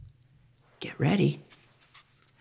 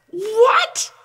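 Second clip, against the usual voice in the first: high startled what, rising in disbelief; surprised what. Acted. Teacher finding the story repeats, surprised. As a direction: snap up in astonishment.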